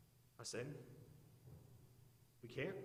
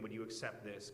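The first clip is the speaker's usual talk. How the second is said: softer, lower, plain asking; honest version; 5 semitones lower, 6 dB quieter than usual. Shown as a demonstration. Preacher showing how people truly bargain, softer and lower. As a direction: quietly lay out the real prayer. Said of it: "It's the way that people negotiate".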